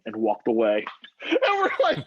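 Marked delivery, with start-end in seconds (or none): 1.3-2.0 high, loud, laughing through the words; laughing disbelief. Real, not acted. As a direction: burst out laughing as you tell it. Reliving the shocking moment.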